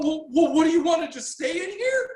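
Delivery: high, frantic mock panic; panicking sergeant. Man mimicking the panicking sergeant, high and frantic. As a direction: voice the sergeant losing his nerve.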